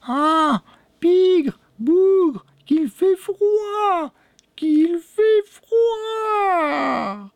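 very high witch voice, long drawn-out words; wicked old crone. A narrator's reading. Lame old woman comes in complaining of the cold, high wailing witch voice. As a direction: wail it high and slow like a witch. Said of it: "un mauvais sourire aux lèvres".

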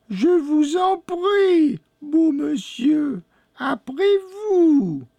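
very high, slow witch voice with a smile; honeyed old crone. A narrator's reading. Old woman politely lets the boy go first, honeyed high crone voice. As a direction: drawl it high, falsely polite. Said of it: "fit la vieille avec un sourire mielleux".

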